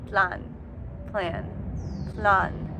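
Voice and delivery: mock dramatic echo fading out. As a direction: echo plan dying away into the distance.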